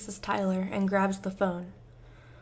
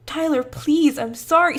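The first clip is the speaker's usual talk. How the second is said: desperate pleading; 7 semitones higher, 9 dB louder than usual. Acted.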